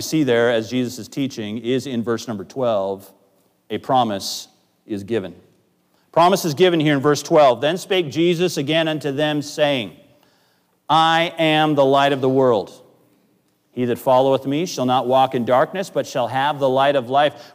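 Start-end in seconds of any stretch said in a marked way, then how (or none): 6.1-17.5 raised preaching voice reading scripture. Acted. Pastor reading Jesus' words to the church, raised and proclaiming. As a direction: proclaim the verse to the congregation.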